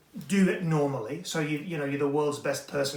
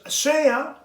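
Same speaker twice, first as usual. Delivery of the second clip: high, loud goodbye shout; loud, high-pitched farewell to viewers; called-out sign-off; 12 semitones higher, 8 dB louder than usual. Real, not acted. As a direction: sign off with a big high yell. Calling out the show's sign-off.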